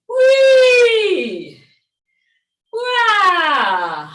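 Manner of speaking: high loud whee then wah; playful shout. Shown as a demonstration. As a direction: release the sound from the belly. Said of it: "as I'm going".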